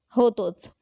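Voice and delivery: ordinary delivery, nothing marked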